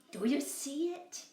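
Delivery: high lamb cry. Acted. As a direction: call it out high. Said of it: "cried Boragita".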